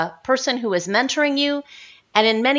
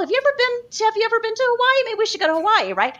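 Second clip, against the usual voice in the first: a dreamy, scattered pitch of options; high, fast mock enthusiasm for every destination; rambling ideas; 7 semitones higher, faster than usual. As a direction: voice climbs much higher, rattling off places. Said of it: "it'd be like".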